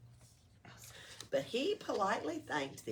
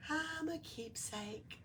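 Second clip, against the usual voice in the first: proud; 10 semitones higher, 7 dB quieter, slower than usual. A narrator's reading. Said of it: "she said proudly".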